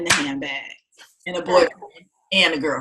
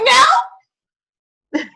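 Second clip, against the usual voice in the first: mock refusal; 6 dB louder than usual. Flat refusal to therapist.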